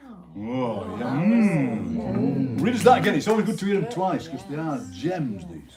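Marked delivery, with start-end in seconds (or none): 1.1-2.6 low appreciative "mm"; murmur of delight. Real, not acted. Host responds to Anne's haiku, delighted murmur. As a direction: murmur approval, low and long.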